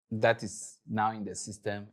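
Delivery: ordinary talk, nothing marked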